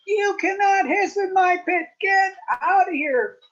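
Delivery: angry neighbour yelling at the snakes; furious shout. Acted. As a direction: throw a full hissy fit. Missus Potts throws hissy fit.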